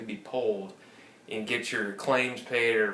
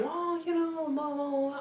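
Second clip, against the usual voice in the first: a much higher, syrupy imitation of the retention agent; high mock sweet agent. The caller mimics the cable rep pitching deals, high mock-sweet voice. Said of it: "every time".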